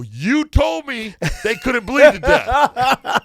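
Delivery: loud, high, drawn-out accusation; mock indignant protest. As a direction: throw it back at the doctor, slow and loud. Retold indignant complaint to doctor, joking.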